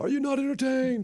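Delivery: high, hammed-up arena taunt; mock Maximus challenge. Host echoes the arena line as a hammed-up taunt. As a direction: throw the line at the crowd.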